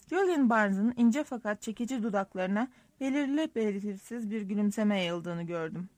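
plain reading voice

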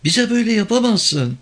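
high, pleading, near tears; tearful protest. A narrator's reading. Woman begs driver not to leave them, tearful. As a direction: protest high-pitched, as if crying. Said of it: "Summa artık ağlamasına karşı koyamıyordu".

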